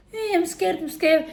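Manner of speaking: high mock-scared whine; mocking fake fear. Mocking the attacker's pretend fear, high and whiny. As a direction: imitate him faking fright. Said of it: "he was pretending to".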